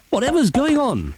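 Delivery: high, alarmed shout above the noise; shouted question. Acted. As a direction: call out over the popping, pitched high. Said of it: "shouted Heggity Haggity".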